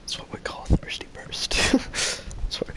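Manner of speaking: soft unvoiced joking whisper; giggly whisper. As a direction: breathe it out with a giggle. Whispered joke about name.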